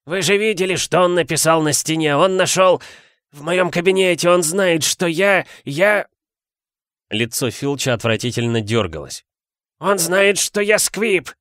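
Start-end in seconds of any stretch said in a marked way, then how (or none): 0.1-6.1 high, agitated accusing outburst; распалённый обвинитель. Acted. Филч в истерике обвиняет Гарри. 9.8-11.3 high, agitated outburst; отчаянное признание. Acted. Филч выкрикивает постыдное признание.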